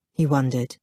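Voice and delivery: plain reading voice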